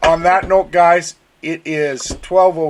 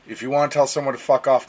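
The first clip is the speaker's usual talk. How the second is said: ordinary talk, nothing marked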